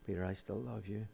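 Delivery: very soft, low, gentle assurance; hushed, forgiving voice; tender reassurance. Acted. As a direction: reassure him softly and lovingly. Voicing the Lord's forgiveness to Peter.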